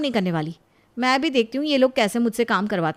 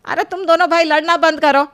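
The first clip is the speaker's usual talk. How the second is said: louder, higher stern scolding; scolding call; 5 semitones higher, 7 dB louder than usual. Acted. Mother tells quarrelling sons to stop, loud scolding. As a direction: stop the fight, loud rebuke.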